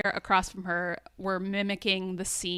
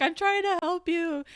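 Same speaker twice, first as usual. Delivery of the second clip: panicked yell; 9 semitones higher than usual. Retold or imitated. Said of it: "he says".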